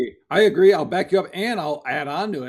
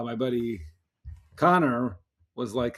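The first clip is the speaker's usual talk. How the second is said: normal talking voice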